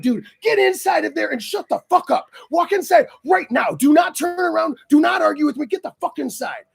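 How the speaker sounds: urgent retold yell, pitched high; a hammed-up angry command to get inside; lost my mind. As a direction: bark orders at him, frantic. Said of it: "I lost my mind".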